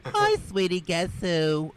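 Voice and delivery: lightly voiced mother leaving a message; a hint of a chatty mom calling her son; mom on voicemail. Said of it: "listen to her messages like this".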